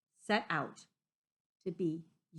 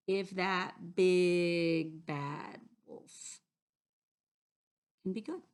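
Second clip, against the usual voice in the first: playful suspense; slower than usual. Acted. Teasing suspense before story.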